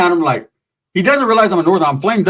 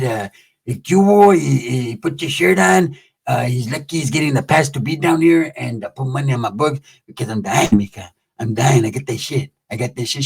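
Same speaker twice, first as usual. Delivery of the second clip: low dragging inmate voice; 4 semitones lower than usual. Acted. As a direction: drag it out low and pitiful. Pepe voiced low and slow.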